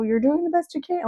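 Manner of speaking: high-pitched mock reassurance; well-meaning people. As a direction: imitate a chirpy well-wisher. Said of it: "when people were like".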